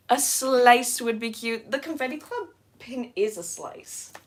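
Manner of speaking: ordinary talk, nothing marked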